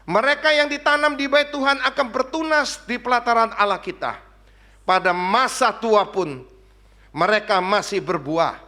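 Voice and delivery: firm, projected verse reading; proclaimed scripture reading. Real, not acted. Preacher reading ayat empat belas aloud, proclaimed scripture. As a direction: ring out each phrase of the psalm. Reads the psalm aloud forcefully.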